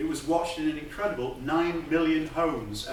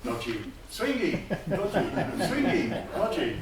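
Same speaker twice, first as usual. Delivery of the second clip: higher-pitched, playful catchphrase chant; imitated catchphrase; 6 semitones higher than usual. Speaker recalls everyone repeating the comedian's catchphrase, playful imitation. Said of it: "we were all going around saying".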